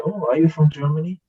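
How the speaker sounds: a hint of a curious American asking; curious stranger. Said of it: "And they say".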